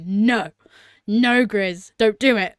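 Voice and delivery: loud, punchy plea retelling a reaction; pleading protest. Retold or imitated. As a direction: cry out in protest at the screen. Acting out tearful protest while watching.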